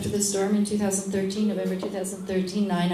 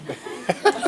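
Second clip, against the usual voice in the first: short laugh; 14 semitones higher than usual. Real, not acted. Laughing at the foreskin joke.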